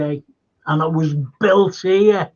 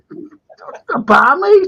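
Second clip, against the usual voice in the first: higher, slow, through laughter; laughing reveal; 9 semitones higher, 6 dB louder, much slower than usual. Retold or imitated. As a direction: laugh it out slowly. Mate laughing at him.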